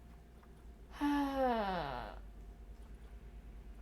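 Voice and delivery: long falling exhausted sigh; long weary sigh. Real, not acted. Worn out by the cat, long weary sigh. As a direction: let it all out in a sigh.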